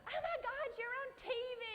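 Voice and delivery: very high squeal with a laugh; starstruck squeal. Retold or imitated. Retelling meeting Tim Gunn, high laughing squeal. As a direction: gush like a starstruck fan.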